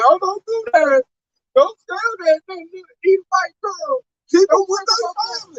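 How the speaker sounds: high pleading mock voice; mock begging. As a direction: whine it like a begging oppressor. Mocking enemies begging.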